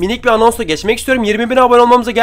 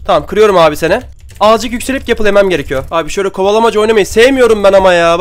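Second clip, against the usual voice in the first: ordinary delivery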